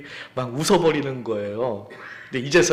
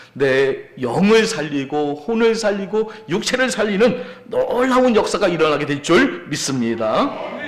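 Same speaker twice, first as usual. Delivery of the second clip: loud, raised-pitch proclamation; fervent preaching; 3 semitones higher, 5 dB louder than usual. Real, not acted. Preacher proclaims the living word to the congregation, fervent preaching. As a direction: drive the promise home to the congregation.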